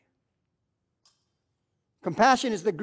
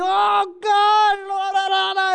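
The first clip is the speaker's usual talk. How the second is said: high, strained cry to God; groaning prayer; 11 semitones higher, 6 dB louder than usual. Retold or imitated. Preacher imitating a praying man, a strained cry. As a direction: moan it out in prayer.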